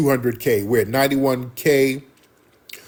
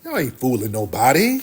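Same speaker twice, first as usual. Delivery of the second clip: ordinary delivery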